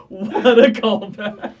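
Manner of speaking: loud, high, drawn out with laughter; slow, loud amused exclamation; real laughing. Real, not acted. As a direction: stretch it out, still cracking up. Still laughing at the callback.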